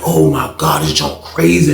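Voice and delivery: deep stunned mumble; low disbelief. Retold or imitated. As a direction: drop it low in awe. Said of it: "I was like".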